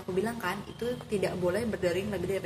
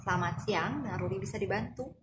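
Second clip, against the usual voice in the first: neutral, businesslike greeting with no smile in it; unsmiling phone greeting. Shown as a demonstration. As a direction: answer the phone without a smile. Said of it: "aku nggak tersenyum ya".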